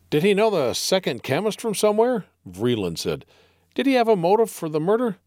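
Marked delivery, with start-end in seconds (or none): 0.1-2.3 curious question. Acted. Vreeland asks. 3.7-5.2 eager question. Acted.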